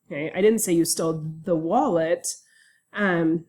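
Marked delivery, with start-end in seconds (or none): 0.3-2.3 "wallet" stressed at the end of the sentence. Shown as a demonstration. Lecturer demonstrating that something else was stolen, stress on WALLET. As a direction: save the weight for "the wallet". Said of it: "as I move the stress around on these different words".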